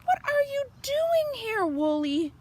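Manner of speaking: very high startled question; surprised farmer. A narrator's reading. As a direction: exclaim in surprise at finding her. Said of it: "says Missus Boot".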